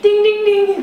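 high loud quiz-show chime; game show ding. Pretend. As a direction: ring it out like a buzzer. Announcing correct answer.

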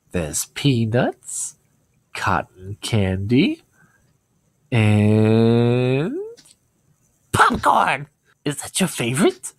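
slow clown voice, leaping high on popcorn and mine too; Pennywise voice, retake. Pretend. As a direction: lure him in, then burst into giddy clown glee. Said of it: "I remember him doing that".

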